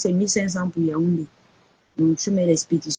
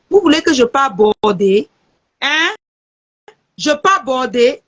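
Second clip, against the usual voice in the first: raised, frustrated voice at the viewers; louder, higher scolding of the audience; exasperated shouting; 5 semitones higher, 6 dB louder than usual. Real, not acted. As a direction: yell it out in real annoyance. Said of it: "Excusez-moi si j'ai crié".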